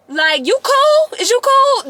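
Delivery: high, slow, loud challenge; challenging. Retold or imitated. To teammates who cursed her out, a high loud challenge. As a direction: dare them to keep going. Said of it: "confronting classmates who cursed her out".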